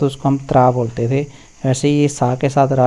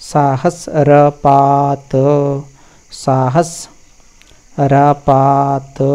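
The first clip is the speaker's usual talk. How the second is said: slow recitation; much slower than usual. Shown as a demonstration.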